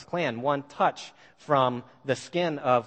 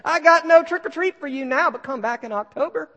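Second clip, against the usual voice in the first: cheerful teasing reply; 14 semitones higher, 6 dB louder than usual. Retold or imitated. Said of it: "he walks right outside and says".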